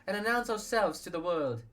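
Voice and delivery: proclaiming leader. Acted. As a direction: announce it like a public vow.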